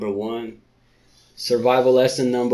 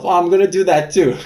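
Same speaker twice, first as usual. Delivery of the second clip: louder, higher, laughing through it; laughing mock; 8 semitones higher, 8 dB louder, faster than usual. Reader mocking Rinda's shiver, laughing. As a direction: giggle while mimicking her.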